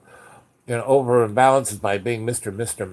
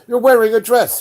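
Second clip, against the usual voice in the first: higher and louder, stunned; shocked exclamation; 10 semitones higher, 7 dB louder than usual. Retold or imitated. The agent seeing Yos in a dress, loud shock. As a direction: blurt out in disbelief.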